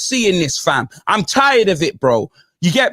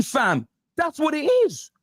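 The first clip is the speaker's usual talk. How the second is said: ordinary delivery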